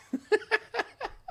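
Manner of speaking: high laugh at the joke; burst of laughter. Real, not acted. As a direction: crack up laughing.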